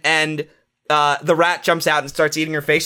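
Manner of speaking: ordinary delivery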